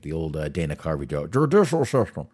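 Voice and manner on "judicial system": put-on loud, higher, slurred drunk bit; drunk-sounding. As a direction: garble it like a drunk in a comedy routine. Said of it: "You sound drunk when you say it".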